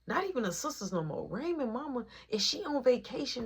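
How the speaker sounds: ordinary talk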